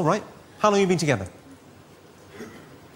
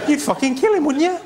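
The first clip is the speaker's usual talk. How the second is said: mock outrage; 8 semitones higher than usual. Egging audience on, outraged.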